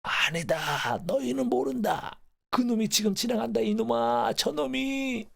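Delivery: very high, drawn-out wailing cry; anguished grandfather. Acted. Dying grandfather insists to his family the train is passing, anguished wail. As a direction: wail in protest, pitch leaping up. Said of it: "조부께서는".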